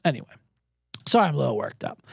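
ordinary delivery

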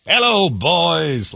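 slow, drawn-out mock greeting; imitated celebrity hailing his fans; saying hello to fans. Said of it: "he leaned out to say hello".

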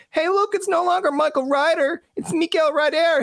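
very high mocking hometown voice; hammed-up heckler teasing a local boy; teasing Newfoundlander. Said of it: "someone in Newfoundland going like".

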